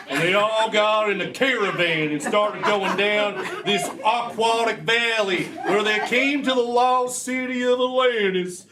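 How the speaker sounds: put-on Texan storyteller; Texas accent.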